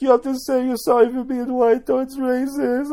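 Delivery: high mocking impersonation; troll's put-on voice. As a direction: scold in a silly high-pitched voice. Troll's put-on voice, ordering people.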